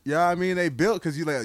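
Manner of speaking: ordinary delivery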